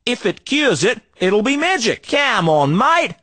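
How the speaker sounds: hammed-up Aussie salesman twang; broad Australian accent. Street trader hawking junk in a broad Australian accent. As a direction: lay on the outback Australian accent thick. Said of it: "we only talk like that".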